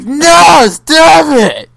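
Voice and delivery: loud, sharp "stop it" yell; angry shout. Retold or imitated. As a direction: shout it like fake raging. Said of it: "That was pretty accurate".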